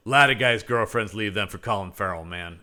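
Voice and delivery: low-key mock roadie voice; roadie impression. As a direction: drop it low at the end, like a roadie. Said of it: "I almost want this guy to go".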